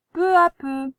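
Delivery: teacher dictating; dictation voice. Shown as a demonstration. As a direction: dictate it clearly. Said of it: "n'oublie pas".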